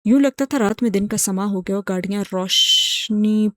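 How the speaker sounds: ordinary delivery, nothing marked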